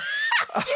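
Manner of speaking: high, loud squeal of laughter; caught-out shriek of laughter. Real, not acted. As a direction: burst out in a shrill laugh. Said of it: "Were you not?".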